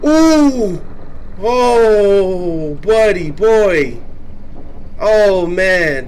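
loud, high relieved cry; relieved yell. Real, not acted. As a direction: shout out relief. Relief after near death.

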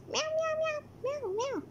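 high soft kitty meows; cat meows. Acted. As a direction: meow like a little cat. Playing the cat.

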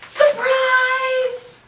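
high, loud "Surprise!"; loud yell. Acted. Friends surprising Deer, loud joyful yell. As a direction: shout it out to surprise her. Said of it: "she heard a loud yell".

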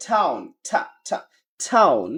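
British "টাউন" starting on open AH; British accent. Shown as a demonstration. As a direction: say "টাউন" as TAH-oon. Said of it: "British ইংলিশে বলে". (British উচ্চারণে)

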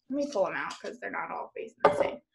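whispered aside to herself; quick whispered aside. Real, not acted. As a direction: murmur it under your breath. Aside while sorting bundles.

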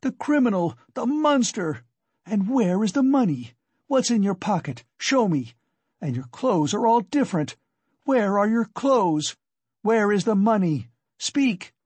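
high-pitched furious accusation; frenzied cry. A narrator's reading. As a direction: rail at him in a frenzy. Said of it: "she cried out in a frenzy".

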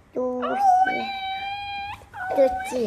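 high squeaky dolphin voice. Pretend. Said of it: "Suara lumba-lumba".

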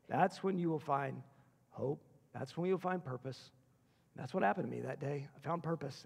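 normal talking voice

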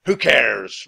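captain roaring at Annie; roared. A narrator's reading. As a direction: roar it out careless and cruel. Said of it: "roared Captain Bones".

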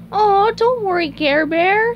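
high, cooing comfort; SpongeBob reassuring. Acted. Owner leaving his protesting snail, high cooed reassurance. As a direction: soothe the pet in a high cartoon voice. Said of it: "SpongeBob reassured Gary".

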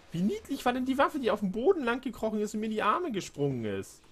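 high excited gushing. Real, not acted. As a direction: squeal over how cute it was. Gushing about the creature weapon. (begeistert schwärmend)

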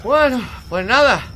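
slow, high, loud exclamation; loud resignation. Real, not acted. Gamer starting over after dying, loud resignation.

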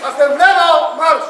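loud, high command. Real, not acted. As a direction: bark the order out. Commanding the next rider.

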